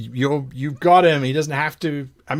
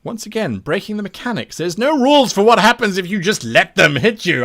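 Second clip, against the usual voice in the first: ordinary delivery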